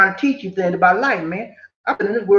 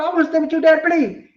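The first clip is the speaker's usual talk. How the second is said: higher, mock tearful begging; retold crying plea; 8 semitones higher, faster than usual. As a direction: beg your dad not to leave you. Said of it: "I started crying".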